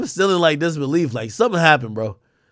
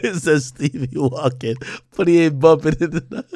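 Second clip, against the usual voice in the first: laughing; 3 dB louder than usual. Real, not acted. Streamer reading out a joke about Stevie walking, laughing. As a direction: read it out through laughter. Reads caption while laughing.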